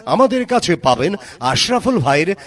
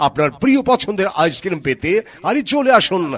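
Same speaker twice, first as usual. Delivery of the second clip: ad announcer; 5 semitones higher than usual. Acted. Loud mic ad promo.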